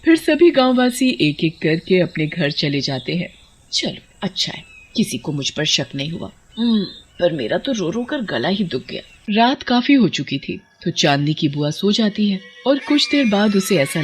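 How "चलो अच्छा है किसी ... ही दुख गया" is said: quiet aside. Acted. Aunt mutters privately after crowd leaves.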